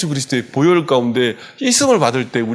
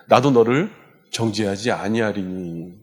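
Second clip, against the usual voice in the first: gentle forgiveness; 5 semitones lower, 4 dB quieter than usual. Retold or imitated. Jesus forgives the woman.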